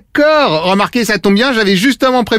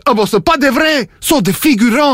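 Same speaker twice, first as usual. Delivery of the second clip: put-on rotten Québécois accent on a prank reveal; bad Québécois accent; 3 semitones higher than usual. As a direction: fake Québécois host, pitched up. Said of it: "mon accent québécois, il est vraiment pourri".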